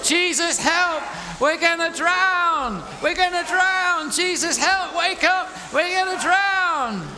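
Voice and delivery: very high, loud, drawn-out cries for help; panicked shouting. Acted. Disciples waking Jesus in the storm, panicked yell. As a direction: scream for rescue, hammed-up panic.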